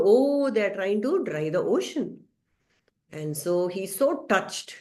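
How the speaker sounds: normal talking voice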